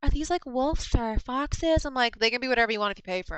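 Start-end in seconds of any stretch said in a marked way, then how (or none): 0.0-1.8 slower, higher customer voice; curious customer. Retold or imitated. Imitating a customer at her booth asking, slow and higher. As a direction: play the browsing shopper.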